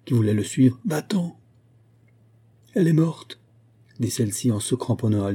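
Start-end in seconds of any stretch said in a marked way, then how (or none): 0.8-1.4 high cry at no great volume. A narrator's reading. Constantin stops Olga following him, crying out. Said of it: "cria-t-il à Olga". 2.7-3.4 high-pitched, understated shock; horrified. A narrator's reading. Olga clinging to Constantin, horrified.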